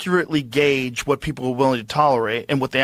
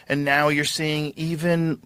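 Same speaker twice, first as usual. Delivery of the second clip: ordinary delivery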